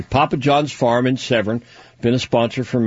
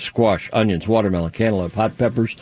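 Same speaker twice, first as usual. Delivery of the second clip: ordinary talk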